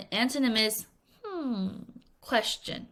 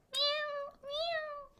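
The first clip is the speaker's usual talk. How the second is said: very high, soft mewing like a cat; cat meow; 11 dB quieter than usual. Retold or imitated. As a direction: imitate a hungry cat meowing. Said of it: "because she kept meowing".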